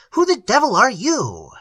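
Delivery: high, loud, blunt challenge; forceful, blunt question; blunt demand. A narrator's reading. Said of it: "said Harper bluntly".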